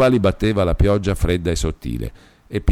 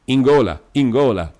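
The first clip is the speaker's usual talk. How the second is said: high, louder, furious repetition; almost beside himself; 6 semitones higher, 4 dB louder than usual. A narrator's reading. Demetrio vows revenge to Beatrice, nearly beside himself. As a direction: drive "in gola" home, hard. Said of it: "tornò a ripetere quasi fuori di sé".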